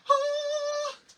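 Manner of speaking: very high, loud laugh; squealing laugh; high squeal of laughter. Real, not acted. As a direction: let out a delighted squeal. Laughs while crafting.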